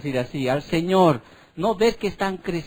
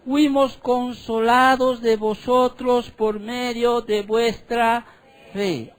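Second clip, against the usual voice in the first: raised, slow, emphatic Bible reading; higher, louder solemn scripture read; preaching scripture reading; 4 semitones higher, 4 dB louder than usual. Acted. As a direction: proclaim the verse to the congregation, drawing it out. Reads scripture aloud in preaching style.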